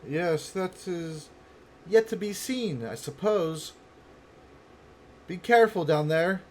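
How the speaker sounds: high, projected woman's voice; guarded female character. Acted. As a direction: call down measured directions to strangers below.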